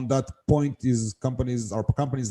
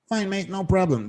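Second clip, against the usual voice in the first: imitating Ernie; 8 semitones higher than usual. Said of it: "That's how he talks".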